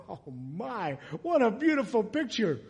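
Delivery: ordinary talk, nothing marked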